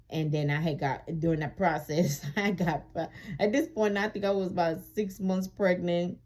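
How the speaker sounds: usual speaking voice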